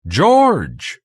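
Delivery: high, loud, drawn-out call. A narrator's reading. Narrator calling down to an old friend in the pit, high shout. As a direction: shout the name to someone far below. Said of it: "I called down".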